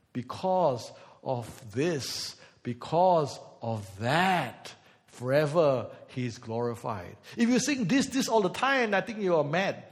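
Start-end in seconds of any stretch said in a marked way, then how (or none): 0.1-7.2 very slow, much lower drawn-out words; lower, slow mock-solemn crescendo; mock dramatic build. Shown as a demonstration. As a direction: stretch each phrase like an overblown worship song. Parodying emotional worship song.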